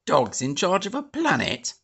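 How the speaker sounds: mock the very idea. A narrator's reading. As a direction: Major mocks Space Boy's claim. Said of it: "mocked the Major".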